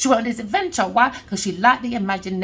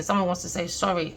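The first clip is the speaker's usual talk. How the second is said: normal talking voice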